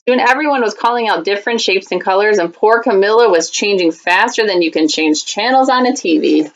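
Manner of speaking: ordinary delivery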